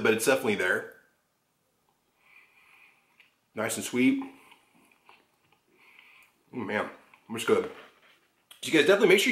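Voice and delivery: speaking between bites; talking while chewing. Real, not acted. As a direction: mouth partly full of cake. Seen 3: Holds cake forkful, bites at 3.3s, then talks while chewing.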